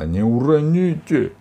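loud, slow, high lament; witch's wail. A narrator's reading. Witch fretting over tossed golden eggs, drawn-out wail. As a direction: wail it out, stretching the words. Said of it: "причитала".

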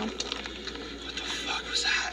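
whispered in disbelief; stunned whisper. Real, not acted. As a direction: breathe it out, stunned. Stunned after the call.

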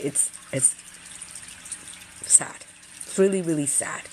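softly, fighting back tears; getting emotional. Real, not acted. Vlogger moved by videos she watched, choked up. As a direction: voice dropping to a near-whisper, choked up. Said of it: "I'm getting emotional talking about this".

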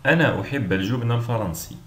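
usual speaking voice

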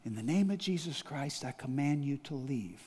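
quiet, hushed command; said quietly. Retold or imitated. Pastor at night, not waking his wife, quiet command. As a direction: command it softly so the wife keeps sleeping. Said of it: "I said it quietly".

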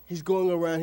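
normal talking voice

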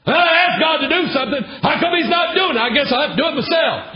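high, loud, exasperated rant ending in a shout; frustrated believer. Acted. Preacher acting out a believer who gives up on God and shouts. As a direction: voice a churchgoer fed up with waiting on God.

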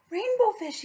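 high, loud little fish calling out; squeaky little blue fish calling. Acted. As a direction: call after him eagerly. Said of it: "he called".

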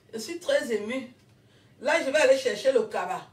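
ordinary talk, nothing marked

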